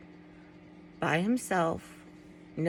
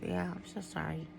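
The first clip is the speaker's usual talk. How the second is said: soft and halting, with long pauses and a sigh; hushed, apologetic, thrown by a sudden memory; upset, losing the thread; 12 dB quieter than usual. Real, not acted. Vlogger overcome remembering her Great Dane, quietly upset.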